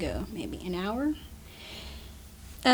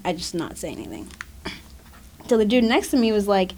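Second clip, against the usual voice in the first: ordinary talk, nothing marked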